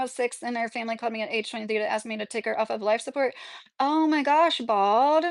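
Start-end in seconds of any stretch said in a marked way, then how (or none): none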